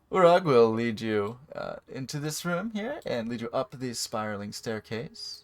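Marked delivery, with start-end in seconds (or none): none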